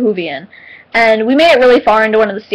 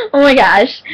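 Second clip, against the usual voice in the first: normal talking voice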